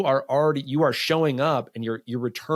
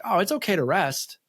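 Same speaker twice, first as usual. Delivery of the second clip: lightly voiced wife, a higher, soothing lilt; quoting the wife kindly, pitched up; wife's gentle permission; 7 semitones higher than usual. Husband quoting his wife telling him to rest, higher and soothing. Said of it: "And she's like".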